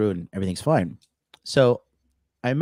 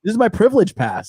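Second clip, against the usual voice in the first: higher, louder, laughing through it; laughing joke; 12 semitones higher, 8 dB louder than usual. Pretend. Joking with friend about airport guards, laughing.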